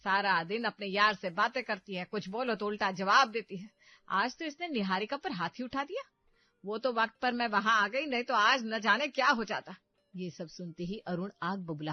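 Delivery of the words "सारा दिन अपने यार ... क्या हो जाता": loud, exaggerating accusations; complaining mother. Mother poisons son against his wife, loud. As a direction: spice up the story, loud and indignant.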